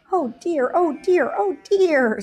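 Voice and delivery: put-on older sheep panicking over the injured shepherd; worried. A narrator's reading. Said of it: "beside themselves with worry".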